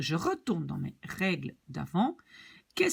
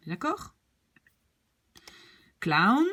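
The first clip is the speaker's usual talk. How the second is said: ordinary delivery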